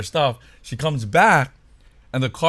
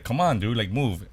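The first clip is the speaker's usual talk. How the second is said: normal talking voice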